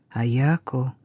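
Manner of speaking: low, softened call to a daughter; quiet call. A narrator's reading. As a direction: call out softly, pitched low, not whispered. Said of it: "её тихо окликнули".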